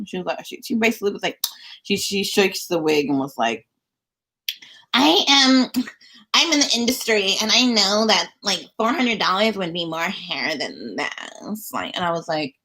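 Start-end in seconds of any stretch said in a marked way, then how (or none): 4.9-11.7 mocking stylist. Stylist claims industry know-how.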